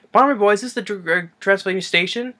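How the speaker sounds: high, lilting call; traveller calling out. Retold or imitated. Traveller asking a kid for the station, high call.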